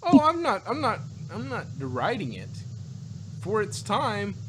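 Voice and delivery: normal talking voice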